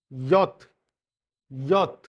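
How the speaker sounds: यॉत as "yot"; emphatic, separate यॉत; German letter J. Shown as a demonstration. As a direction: model यॉत twice, y sound and clear final t. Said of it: "alphabet को ही यॉत बोला जाता है".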